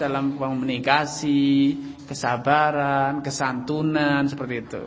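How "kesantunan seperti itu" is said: ordinary delivery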